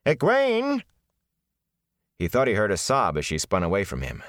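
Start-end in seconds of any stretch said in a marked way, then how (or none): 0.1-0.8 calling out loud; shout. Acted. Nynaeve calling Egwene from the house, loud shout. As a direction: call her from the house.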